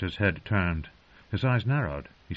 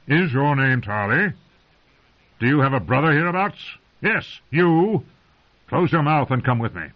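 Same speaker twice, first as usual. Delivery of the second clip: put-on a stern old lord, louder and higher than the narration; gruff old commander; 8 semitones higher, 6 dB louder than usual. A narrator's reading. Old lord commander to his men, stern and loud. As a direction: bark out a commander's orders.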